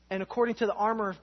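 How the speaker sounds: ordinary talk, nothing marked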